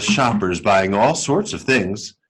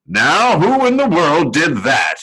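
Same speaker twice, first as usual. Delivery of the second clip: louder, higher startled watchman; exclaiming watchman; 6 semitones higher, 4 dB louder than usual. A narrator's reading. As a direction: call out into the dark store. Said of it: "he exclaimed".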